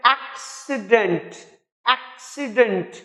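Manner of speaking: "Accident" with एक् punched and the rest light; AK-si-dnt for "accident"; stress on first syllable. Shown as a demonstration. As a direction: hit the opening of "accident", then drop off. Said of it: "stress पहले हिस्से पर है".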